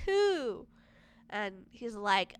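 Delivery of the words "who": high-pitched imitation of a boy asking; little boy. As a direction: voice a puzzled child, pitched well up. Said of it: "the little boy's like".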